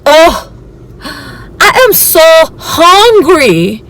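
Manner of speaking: whiny complaint. Retold or imitated. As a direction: moan it like a starving, worn-out woman. Jenny whining about hunger.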